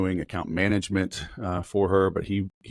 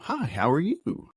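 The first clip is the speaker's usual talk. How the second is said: canned sales greeting; 9 semitones higher than usual. Retold or imitated. Typical sales opener mocked.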